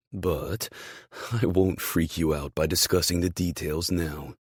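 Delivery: ordinary delivery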